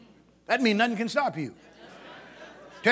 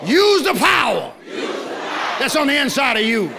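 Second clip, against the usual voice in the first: louder, higher shouted charge; preaching shout; 5 semitones higher, 6 dB louder than usual. Acted. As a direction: fire it at the congregation.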